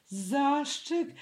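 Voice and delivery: high, startled cry of fright; high alarmed gasp of a word; frightened exclaim. A narrator's reading. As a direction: yelp at the word like it bites. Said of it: "wykrzyknął Puchatek".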